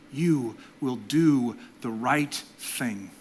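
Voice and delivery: every word of "you will do the right thing" drawn out slowly; slow, weighty "you will do the right thing"; slow blessing. Real, not acted. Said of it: "fourth blessing spoken slowly".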